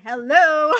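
high, pointed hello; indignant hello. Retold or imitated. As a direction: call it out like an ignored kid proved right. Acts ignored child.

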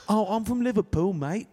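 Liverpool accent sliding into Jamaican leprechaun; high, muddled Liverpool accent with a Jamaican lilt; botched Liverpool accent. Shown as a demonstration. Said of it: "That was the Jamaican leprechaun".

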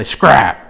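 "Scrap" low and clipped with a hard start; "Scrap" with a sharp low fall; said a certain way. Shown as a demonstration. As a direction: spit out "Scrap" low and short. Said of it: "you gotta say".

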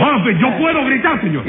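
juez indignado, en tono agudo; gritón indignado. Acted. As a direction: defiende tu derecho con voz muy aguda. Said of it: "el que más grita aquí eres tú". (réplica aguda y acalorada)

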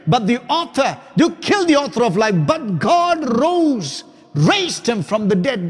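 high, loud, accusing oration; bold address. Acted. Peter addressing the men of Israel, loud and accusing. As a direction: preach it like Peter confronting the crowd. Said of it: "stood firm and gave an address".

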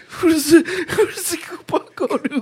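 high wheezing laugh-talk. Real, not acted. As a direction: squeak it out through laughter. Laughing fit, high squeaky.

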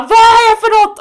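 high, loud mock outburst; högljutt utrop. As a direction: shout it in exaggerated bafflement. Återger sitt utrop till kompisen. (uppspelt, högt och förtvivlat rop)

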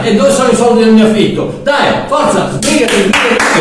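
high, loud furious landlord; hammed-up landlord ranting about the rent; angry landlord. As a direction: bark at the tenant to get out. Landlord yelling at Mario.

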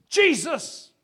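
screamed name. Acted. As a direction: cry out for rescue. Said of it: "And they scream this".

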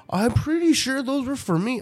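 high, childish mock kid voice; imitating her son. Mother imitating her son asking for his shoes, high mock kid voice.

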